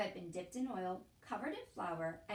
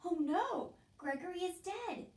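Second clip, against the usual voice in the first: higher, small dismayed voice; dismayed mouse; 9 semitones higher, 4 dB louder than usual. A narrator's reading. Despero the mouse learning Gregory is dead, small and dismayed. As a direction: let the news sink in, stunned.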